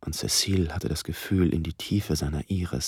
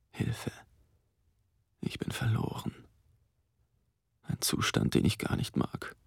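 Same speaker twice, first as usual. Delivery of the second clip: leise erschrocken; 5 semitones higher, 4 dB quieter than usual. Acted. Erschrockener innerer Ausruf.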